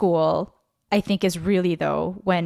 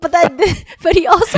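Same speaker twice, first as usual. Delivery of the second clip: laughing; 9 semitones higher, 7 dB louder than usual. Real, not acted. Laughing through her words.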